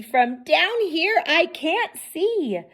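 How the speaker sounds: high egg voice complaining; Humpty's plea. A narrator's reading. Humpty complaining to his friends he can't see, high. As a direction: whine it up high like a small egg.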